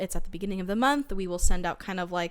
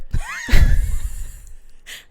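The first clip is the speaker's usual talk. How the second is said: airy, high-pitched giggle burst; high squealing laugh; 10 dB louder than usual. Real, not acted. Laughing at the storm.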